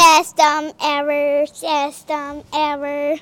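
loud then slow glitching robot; a kid's broken robot voice; rusty robot. Pretend. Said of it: "I am a robot".

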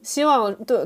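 ordinary delivery

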